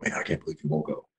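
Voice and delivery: muttered disappointment. Retold or imitated. Son's disappointed reply.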